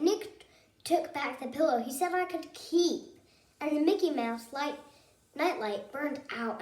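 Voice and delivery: ordinary delivery, nothing marked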